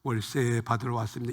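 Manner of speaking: quiet polite request. Retold or imitated. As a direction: say it gently, as the landlord should have. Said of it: "문을 열고 가만히 와서".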